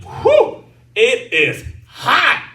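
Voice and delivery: high whoop into slow, drawn-out words; hammed-up, high-pitched sweltering; mock overheated whoop. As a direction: puff out a high whew, then stretch it. Acting overheated on the roof.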